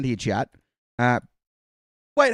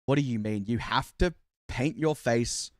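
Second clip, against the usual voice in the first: usual speaking voice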